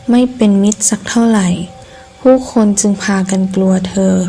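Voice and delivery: ordinary delivery, nothing marked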